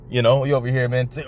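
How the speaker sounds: normal talking voice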